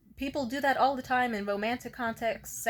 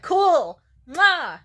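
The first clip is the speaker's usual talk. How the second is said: breezy brush-off; 6 semitones higher, 11 dB louder than usual. Retold or imitated. Brushing off a partner's past.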